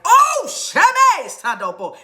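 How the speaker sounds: high, slow, drawn-out exclamation; retold outburst. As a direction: burst into a stretched, high cry of revelation. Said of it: "I was like".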